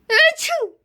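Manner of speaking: loud high sneeze; sneezing. Acted. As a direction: sneeze it out comically. Character sneezing.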